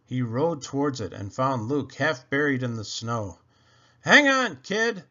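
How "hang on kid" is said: much higher, loud call through the storm. Acted. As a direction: shout to the buried friend to hold on. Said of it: "Han said".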